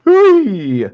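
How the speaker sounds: high, loud playful squeal; playful whee. Real, not acted. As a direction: cheer it out like a game. Playful cry to the dog.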